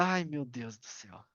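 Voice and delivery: low, soft, fed-up groan under the breath; weary disbelief at a beginner's question; exasperated sigh. Real, not acted.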